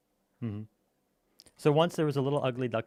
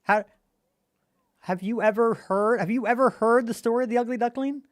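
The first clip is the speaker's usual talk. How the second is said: incredulous; 7 semitones higher, 4 dB louder than usual. Real, not acted. Disbelief at listener.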